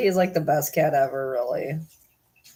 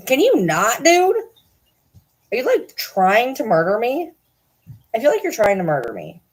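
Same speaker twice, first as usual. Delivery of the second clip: high, louder indignant questions; exasperated protest; 6 semitones higher, 4 dB louder than usual. Real, not acted. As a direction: tell the cat off in pitched-up disbelief. Protesting at the cat.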